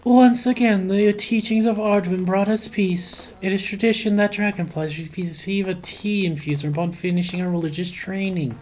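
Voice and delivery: solemn priest voice. Acted. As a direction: read it like a soft-spoken monk. Reads character dialogue in voice.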